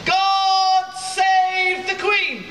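ordinary talk, nothing marked

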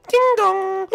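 high sing-out doorbell chime; doorbell imitation. Date arriving at the door, voiced as a high doorbell. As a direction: ring it out high and loud.

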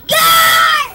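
high, loud call for help; shouting for the guard. Pretend. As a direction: yell for the guard at full voice. Calling the guard in play.

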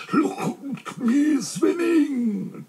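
very high, long drawn-out squeal; tiny triumphant squeak; bubbling proudly. A narrator's reading. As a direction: squeal it proudly through the water. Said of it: "still bubbling proudly".

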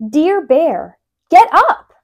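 high, loud wake-up call; shouting. A narrator's reading. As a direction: yell it right in his ear. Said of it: "Mouse shouts in his ear".

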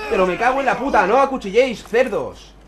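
loud, high cursing rage; furious shout. Real, not acted. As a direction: yell curses at them. Gamer cursing at opponents.

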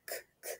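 voiceless k, k barely heard; whispered k sound. Shown as a demonstration. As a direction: click out k, k softly. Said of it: "sound k".

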